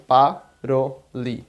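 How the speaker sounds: "paroli" as pa, ro, li; slow syllable-by-syllable "paroli"; separated syllables. Shown as a demonstration. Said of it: "É separado".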